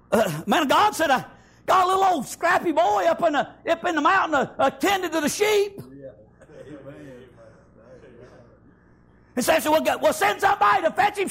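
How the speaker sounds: high, loud, shouted preaching; preaching at full force. Acted. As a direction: shout the story out like a fiery revival preacher.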